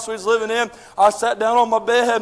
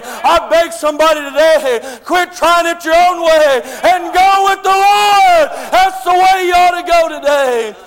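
louder, higher plea building up; pleading shout; 3 semitones higher, 4 dB louder than usual. Real, not acted. Preacher begging the church to follow the Lord, loud pleading. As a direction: beg them to turn back.

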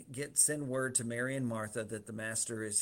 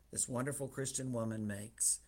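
usual speaking voice

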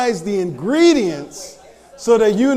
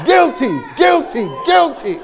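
rising mock shouts of guilty; hammed-up judge calling the verdict; 5 semitones higher than usual. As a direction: audience member declared guilty, shouted.

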